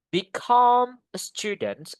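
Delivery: slow, high "become" with a big long "come"; stress on second syllable. Shown as a demonstration. Teacher giving an example phrase, strong stress on "come" in "become". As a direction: stretch and lift "come" in "become". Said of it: "di suku kata yang kedua".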